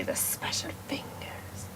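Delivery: soft mysterious whisper. Acted. Teller whispering the key detail to listeners. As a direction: hush it like a secret.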